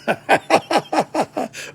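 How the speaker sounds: a bright burst of laughter; high laugh. Real, not acted. Teacher laughing at the French dialogue. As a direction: let a real laugh break out.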